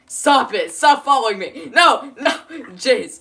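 loud, high shouts of stop and no; panicked yelling. Real, not acted. Yelling at Jace to stop following him, panicked. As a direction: yell as he keeps chasing you. Friend chasing him in game.

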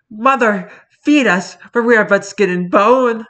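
high, plaintive begging; starving Victorian orphans. Acted. Kittens beg mother for food, high and plaintive. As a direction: plead like hungry waifs. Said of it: "cats talk like this disparage of Victorian children".

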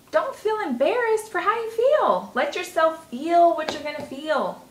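reassuring self-talk. Retold or imitated. Comforting herself after feeling embarrassed.

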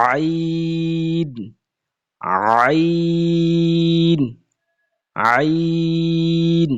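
আইন held very long from the throat; drawn-out letter name. Shown as a demonstration. Quran teacher drilling students on the letter আইন, long and slow. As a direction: stretch আইন slowly, one long breath each. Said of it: "এরপর আসেন".

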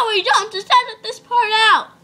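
ordinary delivery

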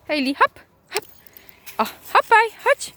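high, sing-song coaxing; coaxing a dog. Real, not acted. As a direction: cheer the dog to jump up. Coaxing dog onto bench. (wysokie, śpiewne zachęcanie psa)

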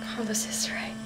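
fast whisper to herself; hushed self-talk; inner whisper. Acted.

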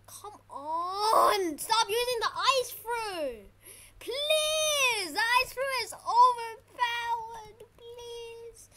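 high, loud, drawn-out complaint; whining plea. Real, not acted. Gamer complaining the ice fruit is overpowered, wailing plea. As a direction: beg in loud frustration, stretching the words. Pleading against overpowered attack.

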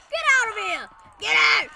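loud, high yell to drive them off; shouting. Real, not acted. Chasing enemy away.

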